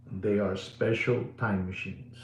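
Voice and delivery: usual speaking voice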